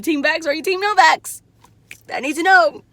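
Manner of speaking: loud insistence on a straight answer; insistent. Real, not acted. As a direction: demand an answer, loud and high. Insisting on her question.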